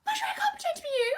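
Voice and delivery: very high, fast protest; high, rushed, helpless plea; panicked protest. Acted. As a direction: squeak it out quickly in panic. Panicked refusal to pretend.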